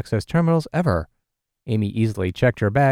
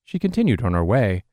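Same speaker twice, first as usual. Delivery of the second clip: ordinary delivery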